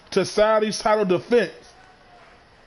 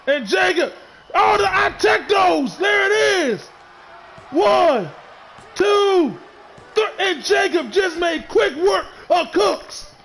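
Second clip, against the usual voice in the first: shouting, much higher, slowed on the big call; wrestling commentator; 9 semitones higher, slower than usual. Acted. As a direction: scream the finish and the three count. Finishing move and pin called live.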